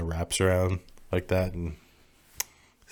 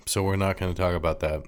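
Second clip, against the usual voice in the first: ordinary talk, nothing marked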